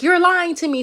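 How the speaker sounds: high, loud mock outrage; acted indignant yell; angry accusation. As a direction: accuse as the livid lady, voice jumping up. Livid lady accuses the girl.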